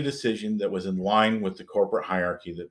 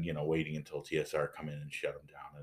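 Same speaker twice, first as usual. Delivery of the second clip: normal talking voice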